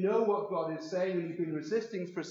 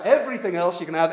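pressed louder with weight on everything; louder insistent repeat; 13 dB louder than usual. Acted. Preacher to congregation, insisting louder on everything. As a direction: drive the point home, louder on everything.

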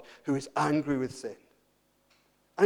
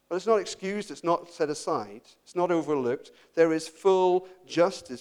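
normal talking voice

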